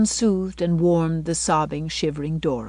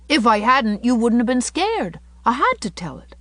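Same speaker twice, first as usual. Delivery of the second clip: put-on higher, emphatic boy voice; frank little boy; 6 semitones higher, 4 dB louder than usual. Davy defends his fib to Anne, matter-of-fact boy. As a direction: justify it as an unrepentant child.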